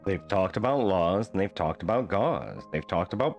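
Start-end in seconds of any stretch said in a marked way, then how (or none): none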